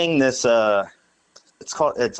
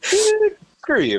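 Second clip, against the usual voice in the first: high, louder indignant snap; squeaky mock comeback; mock retort; 4 dB louder than usual. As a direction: fire back in fake outrage. Retorting to the drunk's insult.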